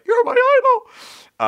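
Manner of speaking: very high, loud, drawn-out squeal; starstruck fan squeal. Retold or imitated. Retelling meeting a voice-acting idol, starstruck squeal. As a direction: re-enact losing it before your hero. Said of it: "I couldn't hold myself together".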